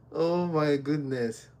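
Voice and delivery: normal talking voice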